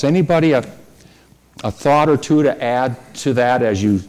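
ordinary delivery

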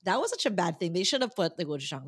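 ordinary delivery

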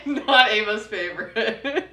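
slow words cracking with laughter; laughing. Real, not acted. Teasing her friend about the fish snack, laughing. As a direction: laugh so hard it almost turns to crying.

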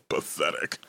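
low, breathy, cold villain sneer; anime villain. Retold or imitated. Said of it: "Like an anime villain".

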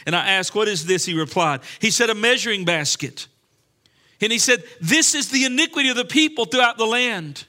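declaimed scripture. Real, not acted. Preacher reads Zechariah to the congregation, declaimed scripture. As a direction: proclaim the verse, building in pitch. Scripture read aloud with force.

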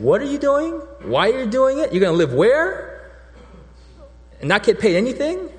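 high, loud, disbelieving questions; incredulous family. Retold or imitated. Family questioning the intern's move, high and incredulous. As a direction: play the family member who can't believe the choice.

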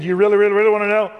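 high, loud, building teasing question; eager teasing question. Retold or imitated. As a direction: dangle it eagerly in front of him. Teasing friend before sharing gospel.

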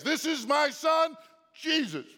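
very high, loud, slow proclamation; booming voice from heaven. Shown as a demonstration. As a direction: thunder it out from heaven. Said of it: "that's how the voice from heaven sounds".

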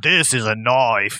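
slow, broad fake Australian accent; mock Australian accent. Citing a line Australians always hear, mock Australian accent. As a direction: draw it out like a movie Australian. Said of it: "Australian people get offended by that".

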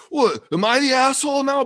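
high, loud, indignant mock Scot; hammed-up Scottish outrage; bad Scottish accent. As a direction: object loudly as an affronted Scotsman. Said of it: "my Scottish accent".

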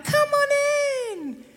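high, long drawn-out sing-song call; mock-welcoming, slow high call; sing-song welcome. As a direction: stretch it out high and inviting. Acting out welcoming the enemy in.

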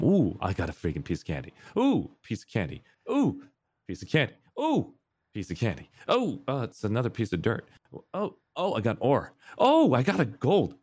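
high squealing "ooh" at each find; childlike delight. Retold or imitated. Four-year-old gamer thrilled at every find, squealing. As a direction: play the thrilled little kid mining. Reenacting kid's delight mining.

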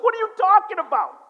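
high, loud mock disbelief. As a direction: exclaim it in retold shock. Disbelief at friends' defeat.

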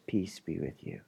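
soft, low, near-whispered peace; tender hush. Acted. Pastor voicing Jesus comforting the grieving. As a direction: lean in and say it gently.

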